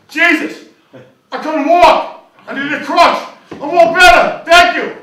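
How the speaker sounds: loud, high excited yelling; crippled man cured, shouting thanks; joyful shout. Acted. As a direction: cheer at being healed. Healed cripple shouting joy.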